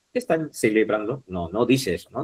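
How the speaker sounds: normal talking voice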